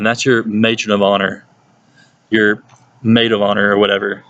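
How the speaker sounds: ordinary delivery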